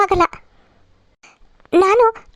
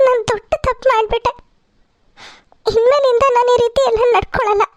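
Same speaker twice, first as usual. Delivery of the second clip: higher, tearful pleading with sobs in the voice; crying loudly; 4 semitones higher than usual. Acted. Daughter-in-law begging the whole family's forgiveness, sobbing. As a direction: beg forgiveness through tears. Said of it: "ಪ್ರಜ್ಞಾ ಜೋರಾಗಿ ಅಳ್ತಾ ಇರ್ತಾಳೆ".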